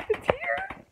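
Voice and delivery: very high, small squeal; excited squeal. Real, not acted. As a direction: squeak with giddy delight. Thrilled unboxing of awaited parcel.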